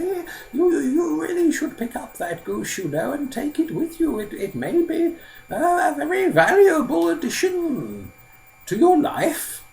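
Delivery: put-on very high, halting elderly voice; old man, advising. Old man urges Simpleton to take the goose, high and halting. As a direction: urge him in a high old-man voice, stretching key words.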